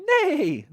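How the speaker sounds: high, loud whinnying neigh; whinny like a horse. Acted. Storyteller voices the horse's neigh. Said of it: "the horse is saying".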